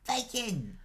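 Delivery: put-on high-pitched child's exclamation; delighted little boy. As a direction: exclaim like a thrilled five-year-old. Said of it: "hold it out to the boy".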